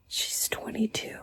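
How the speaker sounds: horrified hushed whisper; slow shocked whisper. Real, not acted. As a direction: breathe it in disbelief. Whispered disbelief.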